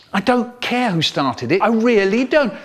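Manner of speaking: high-pitched teacher's telling-off; exasperated scolding. Acted. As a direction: cut off the excuse in exasperation. Teacher scolding squabbling children.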